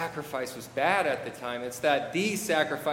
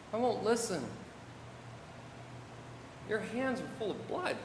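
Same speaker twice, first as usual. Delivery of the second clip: soft, higher-pitched refusal; hushed accusation; 5 semitones higher, 10 dB quieter than usual. Acted. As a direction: drop to a grave hush. God's rebuke drops to hushed accusation.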